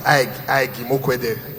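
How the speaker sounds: lightly mocked written stammer; mock stammer, slowed. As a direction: drag out the repeated name like a stutter. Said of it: "he dey even write in stammer".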